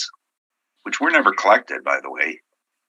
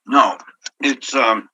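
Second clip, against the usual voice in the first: talking while chewing food; mouth full; 5 semitones higher, 4 dB louder, slower than usual. Real, not acted. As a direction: speak around a bite of food. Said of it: "sorry, I'm eating".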